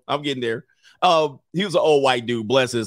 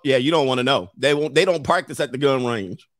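ordinary talk, nothing marked

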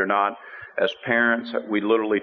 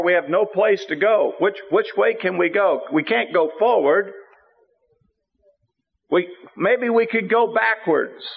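panicked people; 10 semitones higher than usual. Retold or imitated. Israelites panic after spy report.

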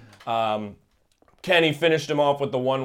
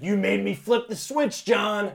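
demonic voice; 5 semitones higher than usual. Retold or imitated. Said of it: "all demonic. He's like".

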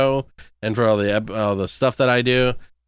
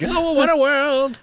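high, slow, fading spirit voice; a drowning ghost's last words; dying spirit voice; 14 semitones higher, slower than usual. Pretend.

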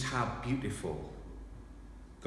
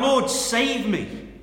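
high, loud, slow cry for rescue; desperate cry; 11 dB louder, much slower than usual. Acted. Peter sinking in the waves, crying out in desperate fear. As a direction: plead like a sinking man.

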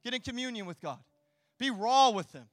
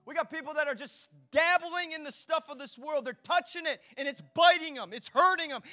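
ordinary delivery

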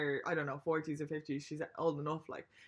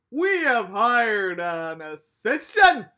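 slow, much louder, higher mock old-man drawl; pompous old bastard; 4 semitones higher, 12 dB louder, much slower than usual. Old panelist dismissing a journalist's question, smug mock drawl. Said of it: "this old bastard".

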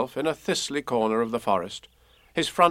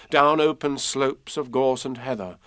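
plain reading voice

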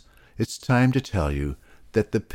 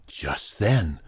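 usual speaking voice

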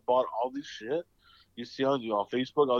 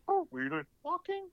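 very high, comic questioning voice; jokey falsetto retelling of a comedian's line; Gabriel Iglesias bit. Retold or imitated. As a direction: squeak out a mock-incredulous question. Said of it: "I think Gabriel Iglesias said that".

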